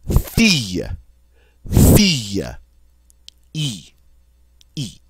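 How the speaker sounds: "fille" with a long ee vowel; French "fille", then "E" alone as in see; long E sound. Shown as a demonstration. Teacher gives French example word, long E sound. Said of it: "it's that long E sound".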